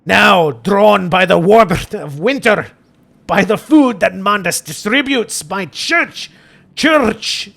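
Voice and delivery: slow, declaimed Scottish with rolled Rs; put-on Scottish accent, drawn out. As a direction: read it as a grand Scottish priest. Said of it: "Father Jeremiah's gotta be Scottish".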